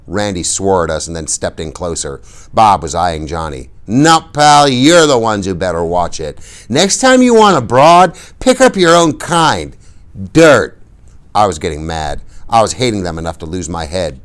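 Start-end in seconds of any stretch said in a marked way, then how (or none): 3.9-10.8 loud, high contemptuous bully; mocking rich bully, louder; sneering threat. A narrator's reading. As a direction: spit the words down at them. Bob threatening the greasers.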